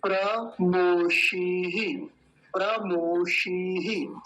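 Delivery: प्रमोषीः very slow, long ई and breathy ह echo; long ई and visarga. Shown as a demonstration. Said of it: "बड़ी की मात्रा और फिर विसर्ग भी है".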